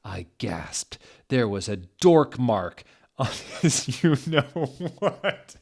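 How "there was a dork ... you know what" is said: slow, laughing through it; real laughter on the words. Real, not acted. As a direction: fight the giggles. Reader laughs mid-line.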